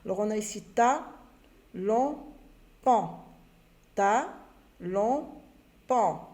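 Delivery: ta, long, pan called out loud and separate; syllables read out. Shown as a demonstration. Teacher reading mixed syllables to pupils, loud and separate. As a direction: read each syllable loud, one at a time. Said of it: "reading syllables to pupils".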